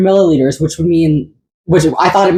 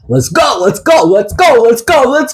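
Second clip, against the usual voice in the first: excited shout; 8 semitones higher than usual. Real, not acted. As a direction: pump yourself up, yelling. Hyping up after caffeine.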